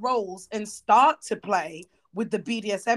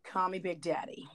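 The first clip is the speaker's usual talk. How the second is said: whispered teasing come-on; low-volume, voiceless mock-sultry whisper; 4 semitones lower, 10 dB quieter than usual. Teasing the friend who hates being called daddy, mock whisper. Mocking the pet name in whisper.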